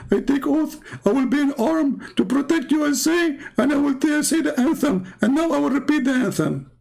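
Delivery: high, mocking caricature reciting an oath; mocking oath-taker. Mocking an immigrant swearing allegiance, high caricature. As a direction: parrot the oath in a silly high voice.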